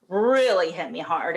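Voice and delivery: normal talking voice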